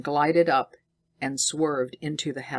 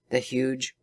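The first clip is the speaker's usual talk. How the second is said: usual speaking voice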